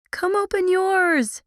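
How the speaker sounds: high, slow excited yell. Acted. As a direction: call out eagerly. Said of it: "she yelled".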